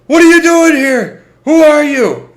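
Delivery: high, loud barking demand; cop shouting. Retold or imitated. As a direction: bark it like a cop at gunpoint. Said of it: "with a cop going".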